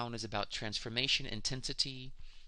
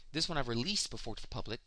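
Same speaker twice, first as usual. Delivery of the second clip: ordinary delivery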